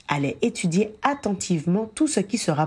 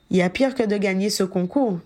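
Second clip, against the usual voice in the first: ordinary delivery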